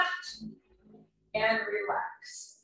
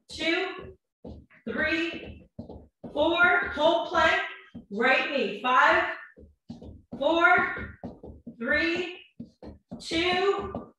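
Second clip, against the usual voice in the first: strained counting; 5 semitones higher, much slower than usual. Real, not acted. Counting reps mid-workout.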